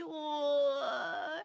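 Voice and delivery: a moved, almost teary aw; touched aw. Real, not acted. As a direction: let it catch in the throat. Touched by cat.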